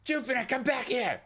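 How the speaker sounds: high, loud summoning yell; yelling from the door. Acted. Eddie calls Jupiter into the back room, yelling from the door. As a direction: holler for someone in another room. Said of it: "like, yelling from the door".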